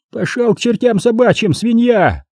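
high-pitched insult, called across; defiant call back. A narrator's reading. Cornered Poles answering from the wall, defiant. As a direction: hurl the curse back from afar. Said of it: "откликнулись от стены".

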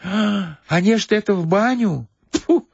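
a hint of a flustered old woman. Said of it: "сказала старушка".

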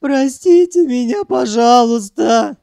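loud, high tearful plea; sobbing. A narrator's reading. Sanyok begging the captives' forgiveness, sobbing. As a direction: wail and beg for forgiveness. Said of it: "зарыдал Санёк".

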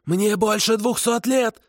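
high, slow desperate kikimora cry. A narrator's reading. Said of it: "отчаянно прокричала Тамара".

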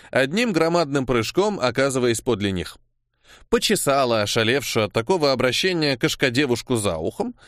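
ordinary delivery

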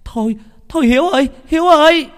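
high, loud, urgent shriek; woman's scream. A narrator's reading. Woman begs Hiếu to stop, screaming. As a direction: scream the name to stop him. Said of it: "có một bà hét lên".

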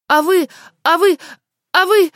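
high, loud sputtering fury; choking with rage. A narrator's reading. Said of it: "чуть не задохнулась в припадке гнева".

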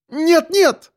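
very high, frightened cry; panicked cry. A narrator's reading. Woman stopping the driver from braking, panicked cry. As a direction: cry out in alarm. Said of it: "закричала Олеся".

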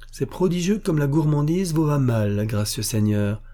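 railler son geôlier, voix plus haute; raillerie. A narrator's reading. (moquerie faussement courtoise)

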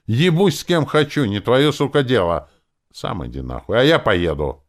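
high, loud angry comeback; furious retort. A narrator's reading. Lenora curses the druid back, furious.

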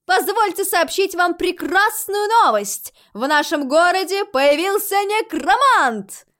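high, loud, slow public announcement; grand proclamation. A narrator's reading. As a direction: proclaim it like big news, drawing it out. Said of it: "торжественно возвестила Рози".

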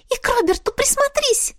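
very high insistent cat voice; a pushy little cat; cat spirit voice. A narrator's reading. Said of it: "не унимался Кикимрик". (настойчиво, высокий кошачий голос)

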